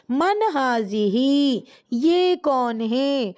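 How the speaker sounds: drawn out slowly for students to copy; slow model question. Shown as a demonstration. Teacher models the Arabic question for students, slowly. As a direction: lay out each word slowly. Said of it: "تو ہم سوال کیا بنائیں گے؟".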